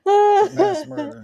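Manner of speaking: slow, loud, drawn-out radio voice; like a dispatcher on a crackly radio; mock police radio.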